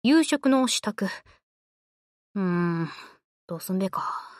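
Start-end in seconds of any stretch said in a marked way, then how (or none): 1.1-4.3 1 pondering. Acted. Pondering what to cook.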